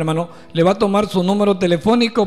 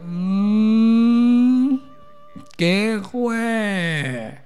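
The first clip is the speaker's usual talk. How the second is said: long groggy hum into drawn-out words; sleepy voice from bed; 4 semitones higher, much slower than usual. Retold or imitated. Hilda answers her mother-in-law from the cot, mock groggy drawl. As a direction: imitate someone too lazy to get up. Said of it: "una voz en el catre que decía".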